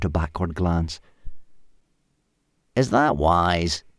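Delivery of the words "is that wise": slow, aged caveman voice; old ape man. A narrator's reading. Ugg the oldest ape man questioning Hal, slow. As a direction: ponder it slowly. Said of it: "asked Ugg".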